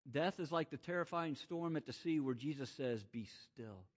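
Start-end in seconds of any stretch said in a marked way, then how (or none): 3.0-3.8 much softer, lower command; quiet firm command; hushed command. Acted. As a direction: hush the storm. Jesus calming storm.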